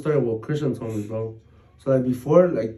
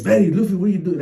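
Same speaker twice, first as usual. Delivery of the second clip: higher, louder retold call to the dogs; 6 semitones higher, 6 dB louder, faster than usual. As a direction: imitate someone calling out to dogs.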